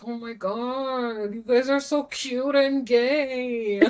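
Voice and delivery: gushing. Retold or imitated. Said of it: "I just couldn't handle it".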